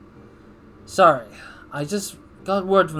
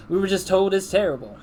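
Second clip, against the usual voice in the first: lightly voiced country accent, a bit higher; 5 semitones higher than usual. Gamer redoing the executive's line in a light country accent. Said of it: "I'd rather just give him a country accent".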